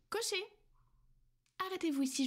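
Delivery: high call to the coachman. Acted. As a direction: call up to the driver outside. Calling the coachman.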